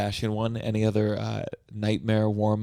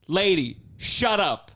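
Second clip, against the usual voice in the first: mock snapping at heckler; 6 semitones higher, slower than usual. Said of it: "be like".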